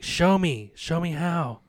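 eager plea. Retold or imitated. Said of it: "to Michael, he's like".

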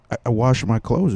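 normal talking voice

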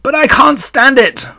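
high, loud protest; distressed shout. A narrator's reading. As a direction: shout it in anguish. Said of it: "shouted Wilbur".

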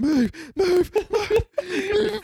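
loud, high-pitched shout to clear the way; retold warning yell. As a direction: yell at people in your path. Retold yelling from pallet jack.

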